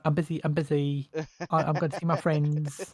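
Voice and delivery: light camp Essex imitation; Essex accent. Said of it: "That's actually how he said it".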